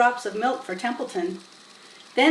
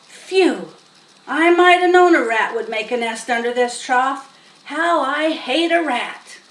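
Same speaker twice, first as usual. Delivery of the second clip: disgusted; 3 semitones higher, 7 dB louder than usual. Acted. Farmhand disgusted at the rat nest under the trough. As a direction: growl out his hatred of rats.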